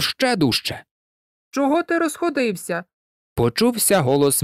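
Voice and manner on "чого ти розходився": put-on girl scolding a dog. A narrator's reading. Malot asks the barking dog what's wrong, high girlish scolding. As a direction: scold lightly in a raised pitch.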